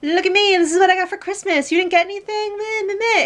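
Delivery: high-pitched taunting brag; hammed-up childish gloating, way up high; mock bragging. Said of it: "this wasn't, like, a bragging".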